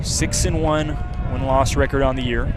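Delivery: ordinary talk, nothing marked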